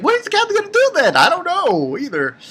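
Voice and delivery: high, fast puzzled onlooker; imitating a lost spectator; mock confusion. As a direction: rattle it off high and baffled.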